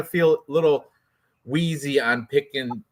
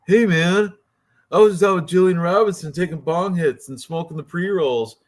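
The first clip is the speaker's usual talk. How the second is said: higher-pitched, rough attempt at an Argentinian accent; stoned mock Argentinian accent; 5 semitones higher, 3 dB louder than usual. Podcaster imitating a high fighter, stoned mock Argentinian accent. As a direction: play a bong-hit fighter with a loose Argentinian accent. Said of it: "Argentinian accent, which I don't even know".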